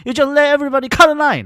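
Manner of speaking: high, loud furious yelling. Retold or imitated. Scolding the staff over the queue, loud high yell. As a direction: bellow it at the staff. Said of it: "我就超大声那个地方大吼".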